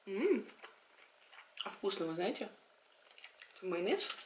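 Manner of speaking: speaking between bites; chewing while talking. Real, not acted. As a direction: mouth partly full. Seen 0: Bites and chews mussel pieces throughout; talks between bites.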